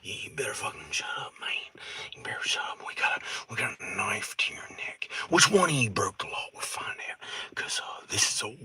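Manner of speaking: whispered threat, fast and hushed; hushed knife-to-the-neck threat; menacing whisper. Retold or imitated. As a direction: threaten him under your breath. Threatening captors in woods.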